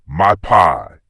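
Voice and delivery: a loud cry of alarm; anguished cry. A narrator's reading. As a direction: cry out in dismay as she runs. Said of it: "With an anguished cry of".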